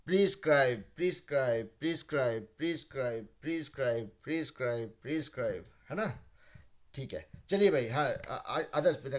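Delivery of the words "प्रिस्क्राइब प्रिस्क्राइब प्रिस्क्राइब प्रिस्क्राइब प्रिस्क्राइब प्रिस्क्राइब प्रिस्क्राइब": प्रिस्क्राइब repeated as pris-kraib, fading little by little; pronunciation drill. Shown as a demonstration. Teacher repeats the word so it sticks, fading repetitions. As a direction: repeat प्रिस्क्राइब until it sits on the tongue. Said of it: "आदत एकदम जीभ पे बैठ जाना चाहिए".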